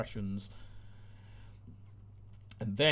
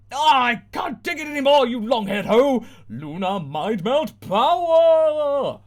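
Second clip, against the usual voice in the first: high loud shout, last line slow; furious shout; 11 semitones higher, 10 dB louder, slower than usual. Acted. Cat snapping at the singing girl, shouting. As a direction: scream and unleash the power. Luna snaps, attacks.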